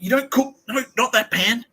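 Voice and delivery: high, loud mock panic; very high-pitched agitated objections. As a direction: imitate a frantic busybody interfering. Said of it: "getting more and more agitated".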